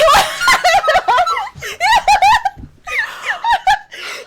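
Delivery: high shrieking laugh; screaming with laughter; loud laughing shriek. Real, not acted. Telling of idol winking at her.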